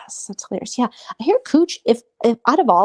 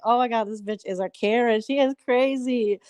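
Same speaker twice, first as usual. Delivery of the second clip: mock disbelief; 4 semitones higher than usual. Speaker's own retold reaction.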